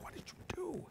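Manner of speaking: sharp unvoiced whisper; whispered manager, horrified. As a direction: hiss it like a horrified boss. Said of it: "My manager walks by like".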